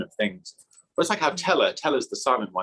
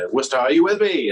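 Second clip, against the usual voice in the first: stage call; 5 semitones higher, 5 dB louder than usual. Retold or imitated. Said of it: "he comes out on stage and says".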